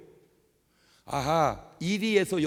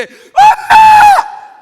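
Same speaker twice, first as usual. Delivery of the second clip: fake, very high funeral shriek; mock daughter-in-law's stagey wail; theatrical wailing; 27 dB louder, much slower than usual. Shown as a demonstration. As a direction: overact a loud, drawn-out cry for mother. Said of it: "그런 걸 연극이라고 그럽니다".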